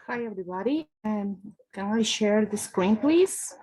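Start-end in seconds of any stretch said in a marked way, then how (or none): none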